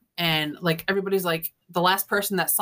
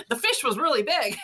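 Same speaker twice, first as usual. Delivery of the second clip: laughing; 9 semitones higher than usual. Real, not acted. Mocking the guy's growing tale.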